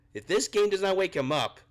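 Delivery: frustrated outburst. Real, not acted. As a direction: hammer the warning home, voice rising.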